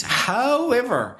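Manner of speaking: However drawn out high and slow; a big, slow, rising However; big emphatic however. Shown as a demonstration. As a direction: lean hard on the word However, stretching it high. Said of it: "and it's a very big however".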